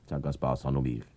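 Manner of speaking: ordinary delivery, nothing marked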